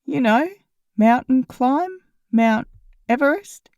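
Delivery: slow, grinning pun delivery; teasing smile. A narrator's reading. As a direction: spell out the joke with a smile. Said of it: "The smile in his voice was unmistakable".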